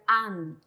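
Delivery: "an" ending on a plain n; pronunciación modelo. Shown as a demonstration. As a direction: demonstrate "an" with only the n sounded at the end. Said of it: "se pronuncia la nian". (pronunciar "an" con n final)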